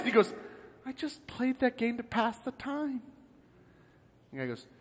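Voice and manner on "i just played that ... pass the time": higher, soft, small-voiced excuse; sheepish admission. Dead man excusing himself to the angel, sheepish. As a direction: shrink back and plead softly.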